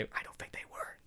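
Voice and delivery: hushed real whisper; whispered aside. Real, not acted. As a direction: breathe the words out under your breath.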